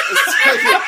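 laughing. Real, not acted. Protesting through laughter.

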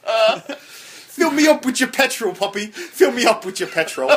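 slow, hammed-up British accent; terrible British accent. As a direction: drag it out in an awful British accent. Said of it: "some of the worst accents ever".

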